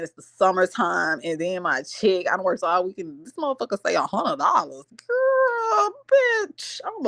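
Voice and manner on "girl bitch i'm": much higher, shocked cry of disbelief; outraged squeal. Retold or imitated. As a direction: squeal at the tiny paycheck. Outraged at tiny paycheck.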